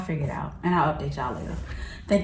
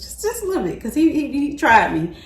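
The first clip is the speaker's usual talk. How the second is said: rising irritation; 7 semitones higher than usual. Real, not acted. Getting mad again retelling it.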